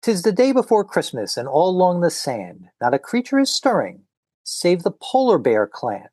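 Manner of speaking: lilting rhyme read aloud, rising; storybook reading. A narrator's reading. Host reading a comic's opening rhyme to listeners, storybook lilt. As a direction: read it like a Christmas poem. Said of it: "I'm going to read you the first two pages".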